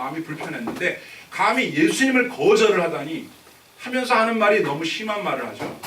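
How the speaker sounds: normal talking voice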